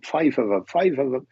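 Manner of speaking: put-on half-hearted Irish accent; attempted Irish accent. Welsh medium repeats the spirit's detail, attempted Irish accent. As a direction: have a poor go at an Irish accent. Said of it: "I can't put on an Irish accent".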